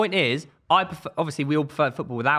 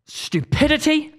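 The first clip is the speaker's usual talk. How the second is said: exasperated rant; 4 semitones higher, slower than usual. Real, not acted. Fan ranting at co-hosts about VAR, exasperated. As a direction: land each slow word with angry force.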